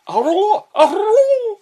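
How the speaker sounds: high rising howl; goblin call. Acted. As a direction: yip it high and laughing. Goblin noises.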